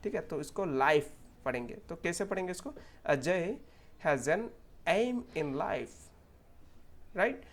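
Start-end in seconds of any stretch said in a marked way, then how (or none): 3.0-6.0 slow word groups, full ei glide in "aim"; correct pronunciation. Shown as a demonstration. Teacher modelling a sentence, slow and clear "aim". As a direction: read slowly, stretching "aim" as "eh-eem". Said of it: "ये हमारा correct pronunciation है".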